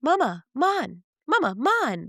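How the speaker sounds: put-on high toddler babble; cute mimic of a little boy. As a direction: imitate a toddler calling mama. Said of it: "He goes".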